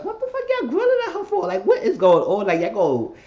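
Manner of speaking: very high, loud, fast incredulous shriek; squealing in disbelief at the staring men; freaked-out squeal. Retold or imitated. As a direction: shriek it out like you can't believe what you're seeing. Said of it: "I was like".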